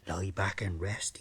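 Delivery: slow, low whispered come-on; a hammed-up seducer whispering low; seductive whisper. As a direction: breathe it to lull her. Chap seduces her on beach.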